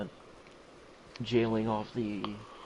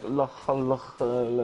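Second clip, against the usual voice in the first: louder gibberish in mock Arabic; mock Arabic accent; 2 semitones higher, 5 dB louder than usual. Friend demonstrating a fake Arabic accent, mock Arabic. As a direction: badly fake Arabic sounds. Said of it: "doing an Arabic accent".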